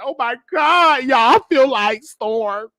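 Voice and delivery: high, slow, whiny mock sobbing; mock crying. Mocking YouTubers who cry on camera, whiny high wail. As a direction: ham up a weepy complaint, pitch way up. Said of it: "I didn't get on here crying like".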